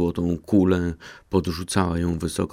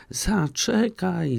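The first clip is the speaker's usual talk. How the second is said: much higher, slow drawn-out frog's cry; frog calling; 12 semitones higher, much slower than usual. A narrator's reading. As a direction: call after her, stretching it out. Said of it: "wołała żaba".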